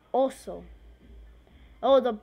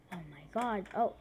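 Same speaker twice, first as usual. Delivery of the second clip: ordinary talk, nothing marked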